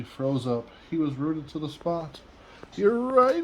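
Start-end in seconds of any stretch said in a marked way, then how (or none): 2.7-3.4 sad giraffe. A narrator's reading. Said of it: "he thought".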